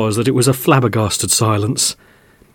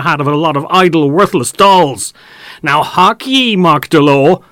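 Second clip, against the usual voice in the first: raised, rustic scolding; scolding farmer; 5 semitones higher, 4 dB louder than usual. Acted. Farmer scolding Mark Delore about the dolls' hats, raised. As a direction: tear into him over his idle tales.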